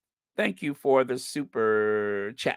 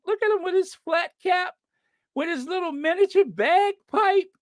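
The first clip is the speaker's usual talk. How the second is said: very high cooing voice; baby-talk gushing; 13 semitones higher than usual. Acted. Host adoring the kid's little kilt, baby-talk gushing. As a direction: squeal over something adorable, way up high. Cooing over a cute kid.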